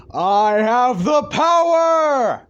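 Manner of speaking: long, high, full-voiced shout; heroic battle cry. Deku raises his hands in the air, triumphant drawn-out yell. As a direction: belt it out, stretching every word.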